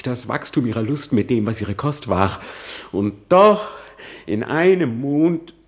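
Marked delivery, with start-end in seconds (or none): 2.9-5.5 in einem Mond shouted high and loud, rising; outraged cry. Acted. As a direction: fling it out in disbelief. Soliloquy, outrage.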